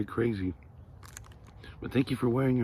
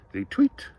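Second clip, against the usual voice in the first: usual speaking voice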